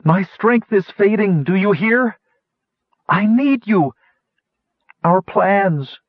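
high, urgent pleading; frantic, strained calling; desperate cry. A narrator's reading. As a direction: plead across the distance as hope runs out. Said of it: "Kitiara cried desperately".

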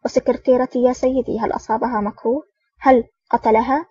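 usual speaking voice

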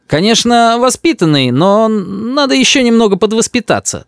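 high, placating grandmother; backpedalling. A narrator's reading. Grandmother takes back her criticism, placating. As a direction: soothe him while retreating. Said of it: "даёт бабушка задний ход".

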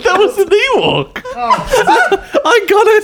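very high squeaky Ewok. Pretend.

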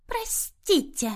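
mother forced to apologize, hissing it out slowly; hissed. A narrator's reading. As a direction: spit the word out grudgingly. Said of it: "сколько прошипела".

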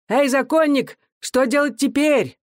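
shouting to him. A narrator's reading. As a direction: call out to him from the crowd. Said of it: "крича ему".

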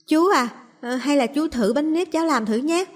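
put-on high, eager child's voice; little girl offering rice cakes. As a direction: pitch it up like a hopeful little girl.

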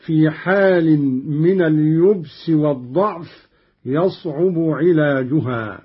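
ordinary talk

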